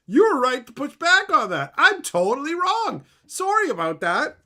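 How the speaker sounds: high, loud, mock-apologetic AI voice; grovelling chatbot. Chatbot backing down after being corrected, high mock apology. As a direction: cave instantly like a sycophantic bot. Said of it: "And you're like".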